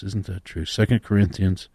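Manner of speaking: ordinary talk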